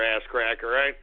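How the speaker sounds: ordinary delivery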